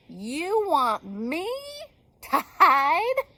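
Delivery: high, loud, wailing bird; loud protest. Acted. Hurt Bird protests loudly.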